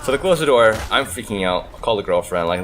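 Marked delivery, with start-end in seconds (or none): none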